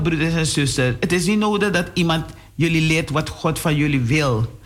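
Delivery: ordinary talk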